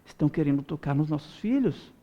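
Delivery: low, softened plea; hushed, grave appeal to God; subdued prayer to God. Acted. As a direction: begin the prayer quietly, voice dropped. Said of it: "irmos na direção de Deus e dizer".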